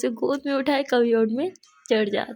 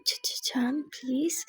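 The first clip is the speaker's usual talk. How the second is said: whisper; 7 dB quieter, much slower than usual. A narrator's reading. Flustered stammering plea.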